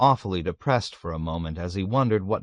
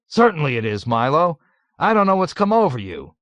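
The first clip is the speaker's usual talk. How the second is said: higher, louder insistent protest; colonel protesting; 7 semitones higher, 6 dB louder than usual. A narrator's reading. Colonel puzzled by Milo, loud high protest. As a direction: brush off his doubts, loudly.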